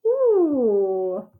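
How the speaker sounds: normal talking voice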